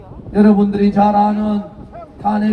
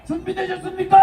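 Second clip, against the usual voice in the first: higher, harder shout to the crowd; roaring rallying call; 6 semitones higher, 4 dB quieter than usual. Acted.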